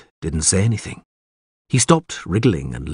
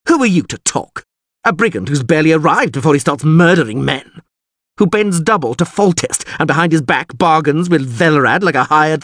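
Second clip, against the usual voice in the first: high, loud indignant tirade; furious yell; 8 semitones higher, 4 dB louder than usual. A narrator's reading. Lord berates the scheming witcher and orders him gone, furious. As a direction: rant at them with haughty outrage. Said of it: "Ostrit yelled furiously".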